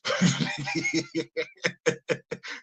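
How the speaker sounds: quiet, drawn-out chuckle; laugh. Real, not acted. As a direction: laugh softly to yourself. Laughs after own punchline.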